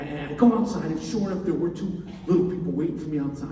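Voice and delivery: ordinary talk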